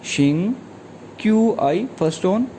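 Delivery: ordinary talk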